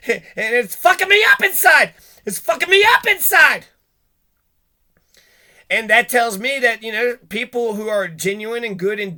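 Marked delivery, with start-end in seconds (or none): 0.1-3.7 much higher, louder, breaking wail; anguished cry. Retold or imitated. Prisoner breaking down over losing himself, high anguished wail. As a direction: wail it out, voice cracking with laughter. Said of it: "there's one scene where he's like".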